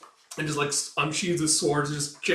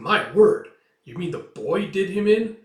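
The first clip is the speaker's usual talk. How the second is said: louder, higher shocked question; astonished exclaim; 6 semitones higher, 8 dB louder than usual. Acted. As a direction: react in amazement. Noble surprised by news.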